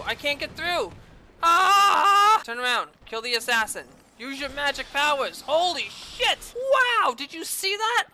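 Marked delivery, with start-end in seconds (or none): 5.5-8.1 high, stunned delight; amazed. Real, not acted. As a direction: gasp it in disbelief. One-shot kill amazement.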